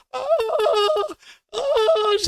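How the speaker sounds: very high, loud mock wail; badly faked sobbing of a wife; robotic fake crying. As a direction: wail mechanically on cue, then sputter out. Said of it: "she was crying like a robot".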